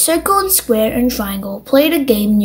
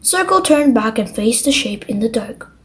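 usual speaking voice

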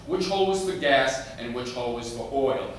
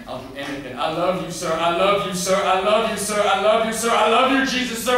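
rising steadily from usual voice to high, loud cries; desperate building plea; 9 semitones higher, 12 dB louder than usual. Acted. Boy begs Jesus in his room, pleading that builds to shouting. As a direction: repeat it with mounting desperation.

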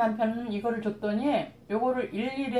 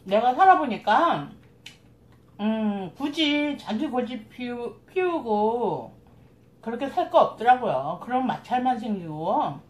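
chewing between words; muffled speech with food; mouth full while talking; 3 dB louder than usual. Real, not acted. Seen 4: Lips pressed, cheeks working between words; food eaten at table just before.

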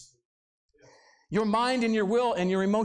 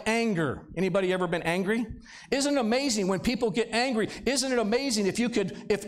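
usual speaking voice

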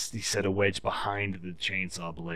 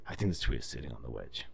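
lower, softer mocking boss; 10 semitones lower, 8 dB quieter, faster than usual. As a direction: play the clueless boss, low and dismissive. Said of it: "he goes".

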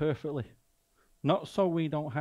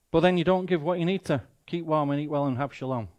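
ordinary delivery

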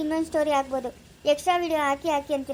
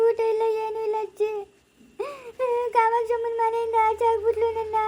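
put-on a higher-pitched, upset young woman; pleading girl; 5 semitones higher than usual. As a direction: plead with grandma not to send her back. (ಅಜ್ಜಿಯ ಬಳಿ ಬೇಡಿಕೊಳ್ಳುವ ಹುಡುಗಿ)

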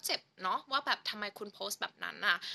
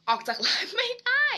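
much higher and louder, rising at the end; playful squeal; 13 semitones higher, 9 dB louder than usual. Pretend. Reading a fan's comment to viewers, with a playful high squeal.

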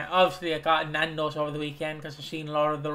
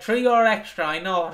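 takeaway staff; 5 semitones higher, 4 dB louder, slower than usual. Retold or imitated. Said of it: "they're like".

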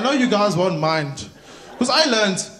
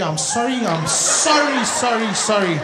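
repeated sorry, peaking then falling; put-on teller's brush-off; insistent apology; slower than usual. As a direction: pile up the apologies to dismiss someone. Teller acted refusing a customer.

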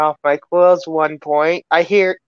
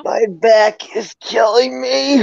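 pained groan; 6 semitones higher, slower than usual. Pretend. Aching character complains.

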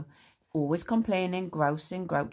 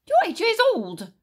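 much higher, louder sharp snap; snapped; 9 dB louder than usual. Acted. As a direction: snap at the boy. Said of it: "snapped Grandma".

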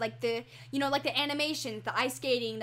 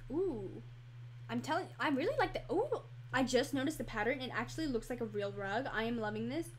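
ordinary delivery

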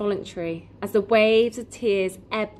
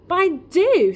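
loud, high, angry retort; heated outburst, pitched right up; fiery insistence; 11 semitones higher, 8 dB louder than usual. A narrator's reading. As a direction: fire back defiantly. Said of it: "with fire in his eyes".

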